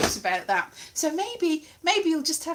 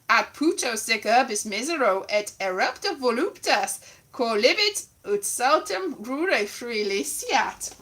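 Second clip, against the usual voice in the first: Latin read aloud in a bad accent. Reading Ovid to the audience, bad Latin accent. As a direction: declaim Latin with a dodgy accent. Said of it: "bad, bad Latin accent".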